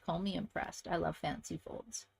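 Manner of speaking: ordinary talk, nothing marked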